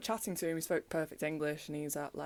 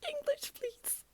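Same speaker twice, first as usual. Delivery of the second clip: much higher squeaky little voice for English, please; squeaky mock plea; 3 dB quieter, slower than usual. Begging the French stranger for English, tiny high squeak. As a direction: play the helpless tourist in a tiny high voice. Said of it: "and I was like".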